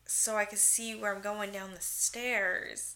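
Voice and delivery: a hint of a timid explanation, unhurried; lightly voiced Aaron. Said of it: "And Aaron's like".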